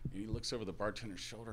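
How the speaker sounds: ordinary talk, nothing marked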